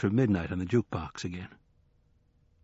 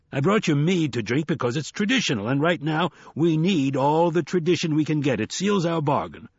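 higher, emphatic voice of a dealmaker; insistent hustler; 5 semitones higher than usual. Acted. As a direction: press the bargain on a reluctant recruit.

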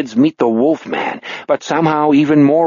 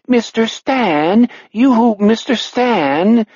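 put-on calling old woman; 10 semitones higher, slower than usual. A narrator's reading. Nice old woman calls out.

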